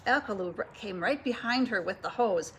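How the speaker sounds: ordinary delivery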